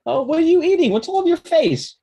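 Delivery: high and loud, startled; surprised. Real, not acted. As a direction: react to a messy face. Father reacts to messy child.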